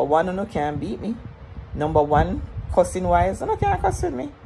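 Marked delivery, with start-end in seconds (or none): 3.4-4.3 high taunting boast. Real, not acted. Boasting she out-cusses anyone.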